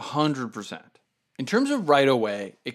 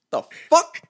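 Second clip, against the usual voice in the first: startled; 6 dB louder than usual. Retold or imitated. Startled by honk while running.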